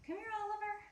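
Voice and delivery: high sing-song call to the cat. Real, not acted. Owner calls her cat Oliver over, high coaxing voice.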